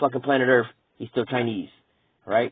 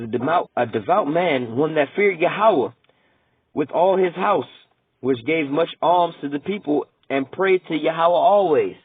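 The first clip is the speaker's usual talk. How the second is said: slow, raised reading of the verse; measured preacher's scripture read; 4 semitones higher than usual. Acted. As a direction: read it out like holy writ. Reads scripture aloud.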